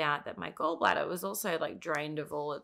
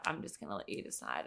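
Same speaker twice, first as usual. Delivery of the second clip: much lower, softer and faster mock surgeon; 13 semitones lower, 9 dB quieter, faster than usual. Patient mimicking surgeon dodging her question, low quick mumble.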